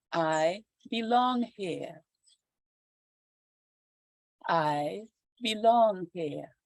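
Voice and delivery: slow, calm meditation voice; guided meditation. Acted. Yoga teacher guiding students into calm, meditation voice. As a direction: ease them into breathing and grounding. Leads spoken affirmations.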